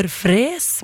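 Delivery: "Fres" with a long E; lang E. Shown as a demonstration. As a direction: draw out the E in "Fres". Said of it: "med lang E". («Fres» med lang e)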